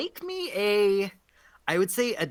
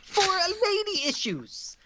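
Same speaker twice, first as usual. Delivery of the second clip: high, slow crone voice; drawn-out, high-pitched hag needling; taunting old hag; 11 semitones higher, much slower than usual. Acted. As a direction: challenge them as a cheeky hag. Hag challenges the paladin.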